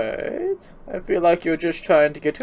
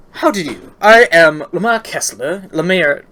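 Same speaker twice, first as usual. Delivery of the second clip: formal gentleman voice; 4 semitones higher, 6 dB louder than usual. Acted. Player in-character formal greeting.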